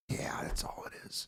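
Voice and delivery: acted whisper, barely any voice; whispered concession. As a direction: give in with a hushed whisper. Partner in fight finally softens.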